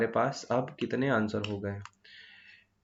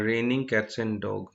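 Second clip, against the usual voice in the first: slow example phrase; much slower than usual. Shown as a demonstration. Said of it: "ये आपने सुना होगा".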